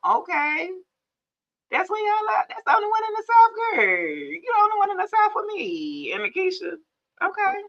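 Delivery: high, quick hype for the one from the South; high-pitched shout-out to the Southern viewer; excited greeting. Real, not acted. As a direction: cheer her on, fast and high.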